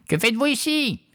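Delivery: very high witch voice; croaking witch. A narrator's reading. Horrible witch confronts the intruding fisherman, high and croaking. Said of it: "croassa la sorcière".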